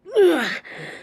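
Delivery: high gloating villain cackle; sharp high mocking laugh; evil laugh. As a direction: cackle down at her. Villain mocks pinned captive.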